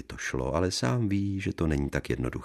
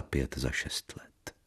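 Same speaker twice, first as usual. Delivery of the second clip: slow, whispered and trailing off; 3 semitones lower, 8 dB quieter, slower than usual. A narrator's reading. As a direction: breathe it out slowly. Despairing inner monologue. (pomalým šeptem)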